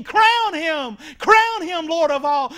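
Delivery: loud, high call of praise; joyful acclaim. Retold or imitated. The religious crowd should acclaim Jesus, loud and high. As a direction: proclaim him king at full voice. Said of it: "aren't saying".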